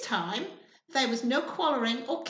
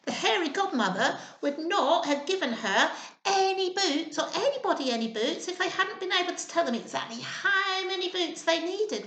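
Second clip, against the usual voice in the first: usual speaking voice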